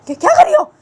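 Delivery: loud high alarmed cry; sharp stop command. Acted. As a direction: yell at her to stop. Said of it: "बोलती है".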